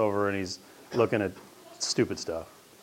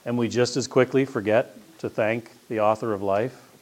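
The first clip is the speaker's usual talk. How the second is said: usual speaking voice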